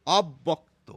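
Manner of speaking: "অব্যক্ত" said as "ob-bokto" with a held double b; doubled b. Shown as a demonstration. Teacher at the board repeats the correct form. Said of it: "বটি দুবার এসে যাচ্ছে".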